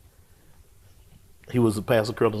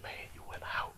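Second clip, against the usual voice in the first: hushed whisper to himself; 17 dB quieter, faster than usual. Retold or imitated. Alone in the empty church, whispering to himself. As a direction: whisper the realization. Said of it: "I said".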